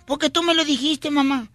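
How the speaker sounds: high, lightly voiced child; little boy. Joke son answers his mother, lightly childlike. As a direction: answer like a kid defending himself. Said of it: "Dice el niño".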